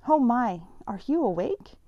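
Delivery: a nurse startled to find her awake; surprised nurse. A narrator's reading. Nurse surprised to see patient awake.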